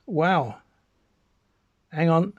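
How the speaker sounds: normal talking voice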